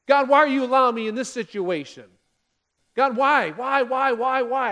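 loud, pleading complaint to God; hammed-up desperate plea; mock grumbling Israelites. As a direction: cry out like whining people in the desert. Preacher voicing grumbling Israelites.

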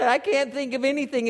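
high mock tearful voice; imitated crying. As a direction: play a man answering through tears. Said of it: "He starts crying".

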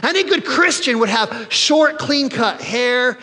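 high, slow, preachy mockery of the rule-keeper; mocking religious voice. Preacher to congregation, mocking the rule-keepers in a high voice. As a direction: lampoon a self-righteous churchgoer.

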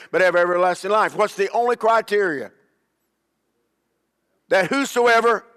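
rising, emphatic sermon cadence; fiery preacher driving the point home; preaching at full force. Acted. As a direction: preach it, building to each "Why?". Preaching climax.

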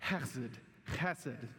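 "Chesed" with a throaty ch, led for the room; Hebrew guttural ch. Shown as a demonstration. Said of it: "let's do it again".

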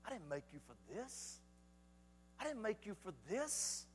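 very soft whispered call, with long pauses; hushed, spaced-out divine whisper. Acted. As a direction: whisper it like God quietly getting someone's attention. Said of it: "He whispers out and he says".

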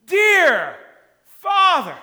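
loud, high cry of "Dear Father"; fervent loud cry. Shown as a demonstration. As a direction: call "Dear" out with full force. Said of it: "Or we might say".

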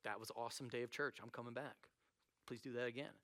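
low, very soft understated amazement; quiet awe. Acted. Congregation amazed after the healing, quietly awed. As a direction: murmur it as a moved churchgoer.

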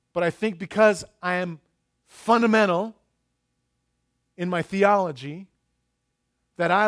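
normal talking voice

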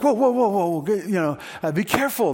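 lightly voiced coach urging caution; alarmed coach. Coach reining in excited kid.

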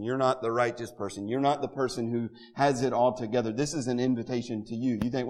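ordinary talk

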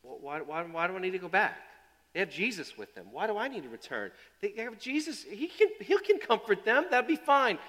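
rising, pleading objection; Lazarus protesting. Acted.

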